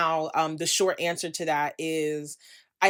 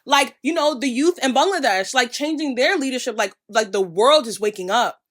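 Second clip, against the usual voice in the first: louder and higher, fired-up rallying; passionate rant; 4 semitones higher, 5 dB louder than usual. Real, not acted. Urging followers the world is waking up, loud and fired up. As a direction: rouse the audience like a protest speech.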